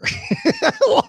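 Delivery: high, sudden laugh; incredulous high laugh; real burst of laughter. Real, not acted. Laughs at the absurdity.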